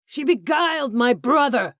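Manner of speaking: high, loud enraged scream. A narrator's reading. Hugh losing his mask and screaming at Corbin, enraged. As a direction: scream it in hatred. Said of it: "he screamed".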